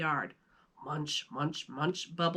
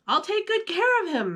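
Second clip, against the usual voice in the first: put-on high, pleading boy's voice; 12 semitones higher than usual. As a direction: beg like an eager kid. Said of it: "Max pleaded".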